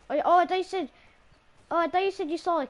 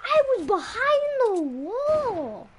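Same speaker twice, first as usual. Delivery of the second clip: loud, high, drawn-out wail; wailing protest; 8 semitones higher, 5 dB louder, much slower than usual. Real, not acted. Player killed behind a wall, wailing protest. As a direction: wail it in swooping disbelief. Killed in game despite cover.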